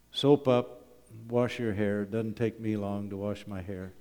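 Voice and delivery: ordinary talk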